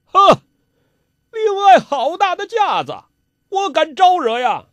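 high, loud mock indignation; scornful retort. A narrator's reading. Rival answering a father's hail, loudly sarcastic. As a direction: throw it back sarcastically at full pitch.

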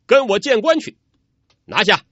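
high, loud arrest command; barked order. A narrator's reading. Officer orders soldiers to seize Yang Tiexin, loud command. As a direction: order the men to seize him.

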